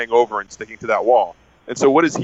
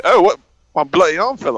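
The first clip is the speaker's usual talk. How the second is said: loud, higher mock British-Irish accent; hammed-up attempt at an Irish accent; Chris O'Dowd; 5 semitones higher, 3 dB louder than usual. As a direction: put on a rough British-or-Irish accent, far too casual. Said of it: "or whatever his accent is".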